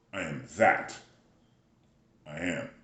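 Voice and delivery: two separate statements, "I am that." and "I am."; comma after THAT. Shown as a demonstration. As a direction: break firmly after "that" before a hushed "I am". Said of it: "The comma actually goes after that".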